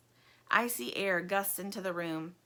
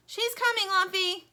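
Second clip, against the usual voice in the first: high, loud reassuring shout; yelling; 12 semitones higher, 6 dB louder than usual. Acted. Plastic yells to Lumpy that help comes. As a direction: shout it out to the yard. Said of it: "yells Plastic".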